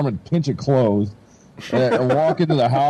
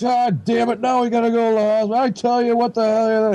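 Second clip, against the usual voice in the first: higher, exasperated mock yell of an annoyed dad; angry dad; 10 semitones higher than usual. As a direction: bark it like a fed-up father. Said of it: "he's more mad about".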